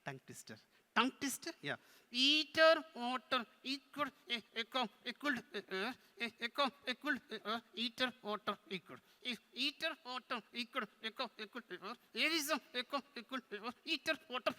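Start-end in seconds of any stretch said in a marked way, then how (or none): 0.9-1.5 higher puppet question; puzzled puppet. Pretend. Puppet queries the term. 2.1-14.5 halting, higher puppet voice; struggling puppet. Pretend. Puppet bungles tongue twister.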